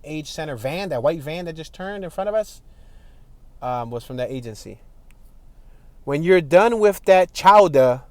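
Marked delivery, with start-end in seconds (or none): 6.0-8.0 put-on slow, louder ad read in a Boston accent. Boston accent, reading a billboard line aloud. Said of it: "that's how they talk".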